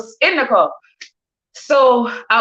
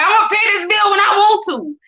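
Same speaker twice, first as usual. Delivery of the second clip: high, cocky mock tough talk; a teasing put-on gangster brag; 9 semitones higher than usual. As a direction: play the gangster, half joking. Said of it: "Nah, I'm just playing".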